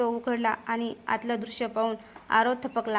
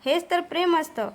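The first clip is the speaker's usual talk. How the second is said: higher, mocking, amused tone; mocking laugh; 6 semitones higher than usual. A narrator's reading. Vikram taunts Aarav over Isha, higher and mocking. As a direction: sneer it out with amusement. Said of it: "विक्रम हसत म्हणाला".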